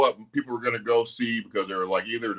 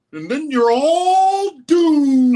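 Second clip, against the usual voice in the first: drawn out, high, loud doomsday warning; slow ominous raspy drawl; 7 dB louder, much slower than usual. Retold or imitated. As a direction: wail the prophecy like a crazy old man. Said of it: "he quoted it just right".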